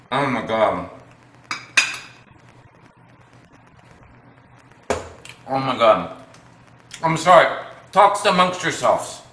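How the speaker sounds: talking through a mouthful; words around a big bite of food; mouth full. Real, not acted. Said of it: "My mouth is full".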